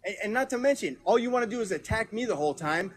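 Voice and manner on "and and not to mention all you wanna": much higher, incredulous and angry; real indignation at being attacked; exasperated outburst. Real, not acted. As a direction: fling the question back at him. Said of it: "No wonder why I'm pissed".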